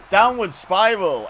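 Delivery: loud, high, stretched-out announcement; excited move call. Real, not acted. Commentator calling Edge's old finishing move, excited shout. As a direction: belt out the move's name, slowly.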